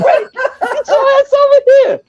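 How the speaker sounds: mock holler across the parking lot, laughing; yelling for Brett. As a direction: friend in car yelling at drunk buddy, mock holler.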